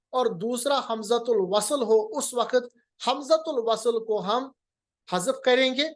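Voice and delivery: ordinary delivery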